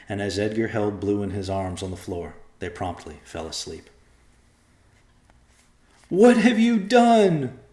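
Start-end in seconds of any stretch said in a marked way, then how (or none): 6.1-7.6 high, loud, slow anguished cry. A narrator's reading. Rachel crying out at her husband, high and anguished. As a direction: wail the question at him. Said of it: "cried Rachel".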